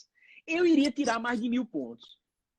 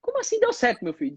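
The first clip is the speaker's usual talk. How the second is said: astonished grandma. Retold or imitated.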